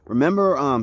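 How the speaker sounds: ordinary delivery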